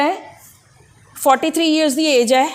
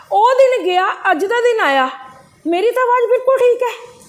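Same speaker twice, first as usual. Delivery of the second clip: much higher, laughing with real delight; a doctor gleefully reporting a cure; 10 semitones higher than usual. Real, not acted. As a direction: tell the good news, thrilled.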